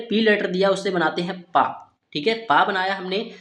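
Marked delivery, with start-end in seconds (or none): none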